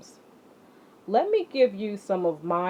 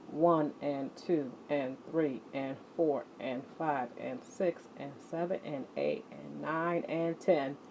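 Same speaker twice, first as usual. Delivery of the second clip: counting along; 3 semitones lower, 8 dB quieter than usual. Acted. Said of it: "counting the ski jumps".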